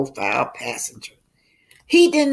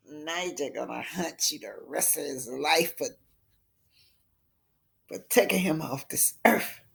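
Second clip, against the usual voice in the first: usual speaking voice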